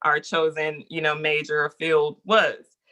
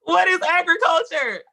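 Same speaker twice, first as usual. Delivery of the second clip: high, loud disbelieving question; can't believe what she's hearing; incredulous; 12 semitones higher, 4 dB louder than usual. Real, not acted. Steered into agriculture, disbelief.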